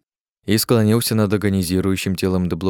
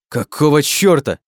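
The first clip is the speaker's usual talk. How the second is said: higher, louder outraged cry; shocked angry shout; 6 semitones higher, 4 dB louder than usual. Acted. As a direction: blurt it out in shock. Oleg shocked by sudden murder.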